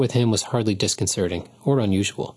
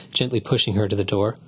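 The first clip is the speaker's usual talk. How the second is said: ordinary delivery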